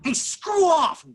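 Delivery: high, loud, drawn-out yell; angry shout. Acted. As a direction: yell it at someone in your way. Coach acts the aggressive pedestrian.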